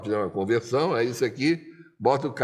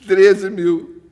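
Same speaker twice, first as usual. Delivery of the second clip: loud bid; 7 semitones higher, 10 dB louder than usual. Retold or imitated. Bidding loudly at auction.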